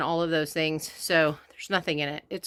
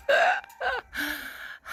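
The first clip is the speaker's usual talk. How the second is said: breathless laugh; 7 semitones higher, 5 dB quieter than usual. Real, not acted.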